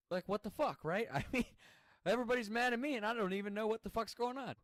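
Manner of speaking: loud, high-pitched exasperation; exasperated venting. Retold or imitated. Storyteller baffled that everyone is mad, exasperated venting.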